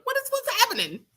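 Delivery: high, stunned squeal; shocked high voice; disbelieving. Retold or imitated. Disbelief at news.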